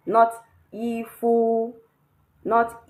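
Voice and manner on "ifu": "ifu" high and level on both syllables; loud, drawn-out, level "ifu"; wrong first tone. Shown as a demonstration. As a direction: slowly hold fu as high as i. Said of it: "there is no tone on the U".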